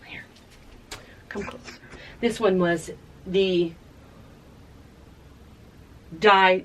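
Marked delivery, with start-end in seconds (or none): 0.0-1.8 voiceless hushed whisper. Real, not acted. Inviting viewers to lean in to the shirt, whispered. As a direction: draw them in with a whisper.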